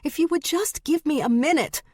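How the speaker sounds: high, urgent appeal; begging. A narrator's reading. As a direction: plead desperately for a moment. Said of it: "Stevie begged".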